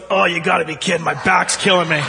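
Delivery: annoyed passenger on the plane, mock whining; complaining lady. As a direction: whine it, fed up and sore. Lady complains about back.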